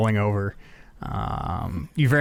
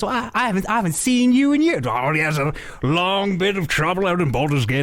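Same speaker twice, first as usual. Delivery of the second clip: high, excited character voices; nonsensical chatter; 9 semitones higher than usual. Acted. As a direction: babble over each other in reunion. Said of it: "very quickly and almost nonsensically talking over one another".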